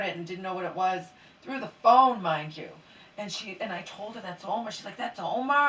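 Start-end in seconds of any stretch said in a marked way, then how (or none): 5.0-5.7 surprised disbelief. Retold or imitated. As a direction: repeat it back in shock. Friend shocked it's the dog.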